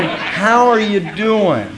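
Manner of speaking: slow, sincere "How are you doing?" meant deeply; sincere greeting. Shown as a demonstration. To a fellow member, asking and meaning it. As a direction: ask "How are you doing?" and mean every word. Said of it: "and meaning it".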